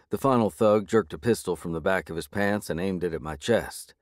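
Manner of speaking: usual speaking voice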